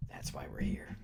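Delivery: unvoiced breathy whisper into mic; asmr whisper. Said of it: "get very close to my mic here".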